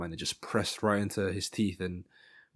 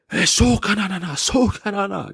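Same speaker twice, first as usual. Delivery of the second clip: much higher, loud mock shouting in gibberish; imitated coach shouting; 12 semitones higher, 9 dB louder than usual. Coach shouting at his fighter from the corner, imitated high yell. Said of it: "coach was shouting at him".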